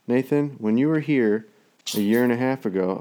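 usual speaking voice

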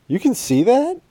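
high, loud incredulous question; caught-out child, voice shooting up at the end; surprised kid. Retold or imitated. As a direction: squeak it in disbelief.

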